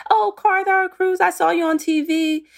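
high, bright imitation of a child; kids' excited voice. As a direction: play the kid who saw her on TV. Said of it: "they'll say".

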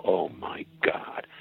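low, slow dread; dismayed groan. Retold or imitated. Audience hearing another impression is coming, low dismay. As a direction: sink into slow, weary disbelief.

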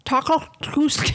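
high, slurred mock denture speech; falling-out dentures voice. Co-host asked for an accent, loose-dentures voice. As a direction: talk as if your false teeth are slipping. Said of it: "sound like you have dentures that are falling out".

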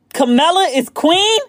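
high, slow incredulous question; can't believe Camilla. Real, not acted. Reacting to queen consort.

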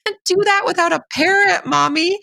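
put-on high, small toddler voice; three-year-old's voice. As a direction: plead like a tiny child to mommy. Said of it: "the three-year-old goes".

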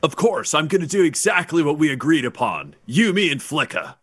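character reply. Acted. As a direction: play the overly obliging tour guest. Player answers in character as Bort.

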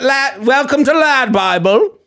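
high, slowed, hammed-up British presenter voice; mock British accent. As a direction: welcome them like an old-fashioned English host. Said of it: "I feel like you're doing old English".

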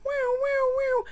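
high steady siren wail; wailing siren imitation; imitating siren. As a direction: sing it like a police siren. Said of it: "it's like".

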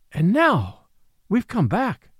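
merry cry. A narrator's reading. Woman to her lover, crying out merrily. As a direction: call out the good news with glee. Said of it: "she cried merrily".

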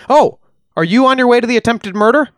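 high, chirpy quoted question; doctor's question. Retold or imitated. Doctor friend on the road, asking eagerly high. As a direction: pipe it up high like an eager gossip.

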